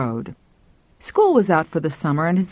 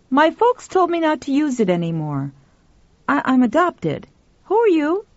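put-on higher-pitched boy's voice, questioning at the end; young boy; 9 semitones higher than usual. A narrator's reading. Adopted boy talking to the woman, high voice. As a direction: answer as a child, pitched up.

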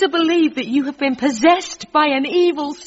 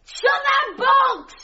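high, drawn-out shout over a gale; desperate shout; 9 semitones higher, much slower than usual. Acted. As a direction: stretch each word in a desperate order.

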